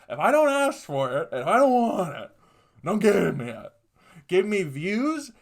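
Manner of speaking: loud, high ranting punchline; shouted moral. Acted. Preaching his moral to the audience, loud ranting. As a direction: yell the life lesson at the crowd.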